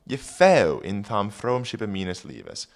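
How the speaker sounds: put-on reading Old English aloud; careful Old English recitation. Shown as a demonstration. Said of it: "rereading the Old English".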